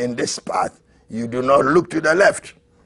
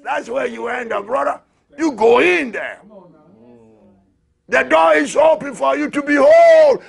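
higher, louder rapture rising; ecstatic preaching; 8 semitones higher, 3 dB louder than usual. Acted. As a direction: lift the congregation toward heaven.

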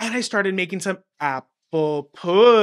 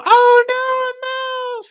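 a much higher, louder squeal of alarm; shocked kids; 6 dB louder, slower than usual. Retold or imitated. Kids in class shriek at the mouse, high and loud. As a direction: squeal it like kids who just spotted a mouse. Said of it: "And they go".

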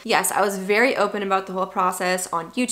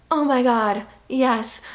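higher, mock-weary oh my God yes; exasperated yes; 4 semitones higher, 4 dB louder, slower than usual. To a pregnant woman offering advice, exasperated. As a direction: roll your eyes at the obvious question.

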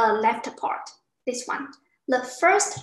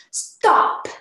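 loud, sharply falling "Stop"; quick falling tone, like an order; acted angry command; 6 dB louder than usual. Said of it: "quickly with anger".

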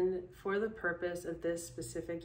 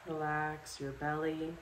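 calm, unhurried guided relaxation with long pauses; guided meditation; 3 dB quieter than usual. Acted. Yoga instructor guiding listeners to sleep in bed, calm meditation voice. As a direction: lead them through it step by step, leaving space after each instruction.

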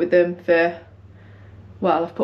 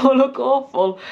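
laughing; 5 semitones higher than usual. Real, not acted. Laughing at her own look.

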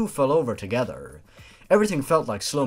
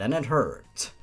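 usual speaking voice

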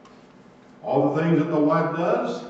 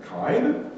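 "Kind?" alone, lower, with a questioning rise; musing question; 5 semitones lower than usual. Shown as a demonstration. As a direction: stop and ponder the word "Kind?". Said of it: "when I read kind, I kinda stop there".

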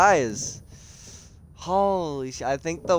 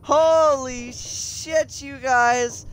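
excited; 10 semitones higher, much slower than usual. Real, not acted. Hype train level complete.

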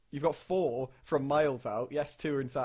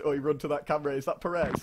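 normal talking voice

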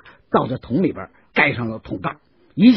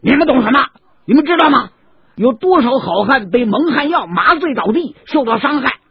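louder, higher angry outburst; furious; 10 semitones higher, 5 dB louder than usual. Acted. 杨志气呼呼地训斥士兵，满是怒火. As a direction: spit out contempt and warn them. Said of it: "杨志气呼的".

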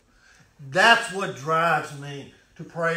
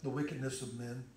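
hushed refrain; 4 semitones lower, 11 dB quieter than usual. Acted.

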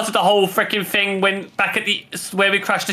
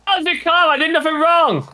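indignant protest; 10 semitones higher than usual. Real, not acted.

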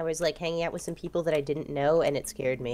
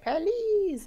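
put-on high little-kid plea; pleading child; 12 semitones higher than usual. Retelling a childhood plea to grandmother, in a high child's voice. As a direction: beg like a small child. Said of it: "And I'd, like, be like".

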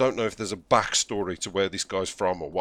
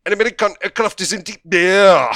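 bad German accent; 8 semitones higher, 10 dB louder than usual. Gamer mocks the game announcer he muted, loud bad German accent. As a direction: mimic the announcer's mangled German accent at a yell. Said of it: "I can't listen to him saying".

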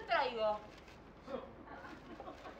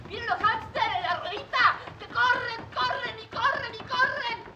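loud excited mockery; 8 semitones higher, 10 dB louder than usual.

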